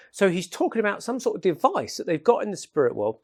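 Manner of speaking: ordinary talk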